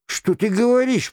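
high, alarmed royal protest; indignant high-pitched question; indignant king. Acted.

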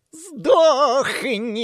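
high, loud vicious shout; gleeful malice. A narrator's reading. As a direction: spit it with savage joy. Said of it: "Злобная радость в голосе Самхайна".

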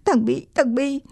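put-on high, whimpering child's voice; acted near-crying, pitched high; tearful stammer. A narrator's reading. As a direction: struggle to get bad news out. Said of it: "tôi đã bắt đầu mếu máu".

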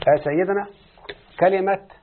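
normal talking voice

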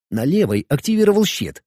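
usual speaking voice

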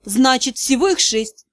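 plain reading voice

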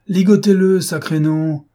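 loud, high, impatient command; angry shouted order. A narrator's reading. Lupin repeats his order to the bandits, angry shout. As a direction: bellow it at men who hesitate.

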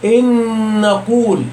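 "إن نقول" with one long doubled nasal n; noun merged into noun. Shown as a demonstration. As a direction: merge both n's in "إن نقول" and hold the hum slowly. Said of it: "والمدغمة في مثلها".

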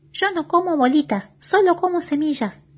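voz aguda de pajarito indignado; pajarito ofendido. A narrator's reading. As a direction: responde muy ofendido, en tono alto. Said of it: "muy ofendido". (pájaro ofendido, bien agudo)

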